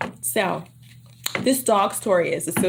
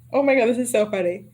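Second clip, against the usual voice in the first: ordinary delivery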